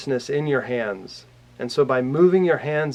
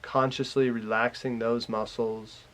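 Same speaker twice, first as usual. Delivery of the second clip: calm meditation guide, growing softer and lower; guided meditation; 3 semitones lower, 7 dB quieter than usual. Real, not acted. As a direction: lead them gently through relaxing, with long pauses. Leads a guided relaxation.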